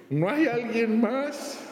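high, pleading comic whine; high whiny punchline. Acted. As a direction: ask hopefully for someone else. Punchline repeated.

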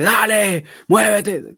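sports coach's high, loud urging; entrenador de deportes. Acted. Sports trainer urging an athlete on, loud pep shout. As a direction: push them on like a motivating trainer. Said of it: "como ese entrenador de, tú sabes, de f-, de deportes".

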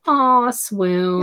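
high, swooning aw. Real, not acted. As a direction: melt over the romance like a fan. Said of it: "This little snake makes me swoon".

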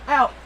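real coughing, pained; coughing fit. Real, not acted. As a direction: cough hard, wince ow.

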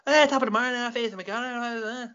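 high-pitched, garbled mock Irish brogue. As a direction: babble in a hammed-up Irish accent. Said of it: "Like, the stereotypical accent".

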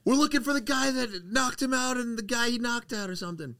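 high-pitched imitation of a woman; station worker lady. Imitating a station worker hunting the culprit, high-pitched voice. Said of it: "so the lady's like".

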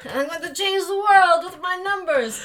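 slow, high hammy Italian voice; Italian accent. Pretend. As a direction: grandiose mock Italian, slowed. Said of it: "my bad Italian accent".

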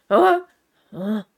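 imitated groggy huh; startled awake. Imitating Dad being shaken out of sleep, startled grunt.

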